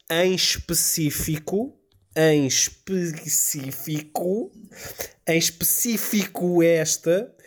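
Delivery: each "em específico" drawn out slowly; slow emphatic repeat. Real, not acted. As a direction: hammer "em específico" home, slowly. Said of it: "vou repetir isto três vezes".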